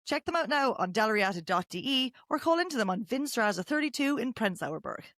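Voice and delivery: podcast host's lively sponsor read. Real, not acted.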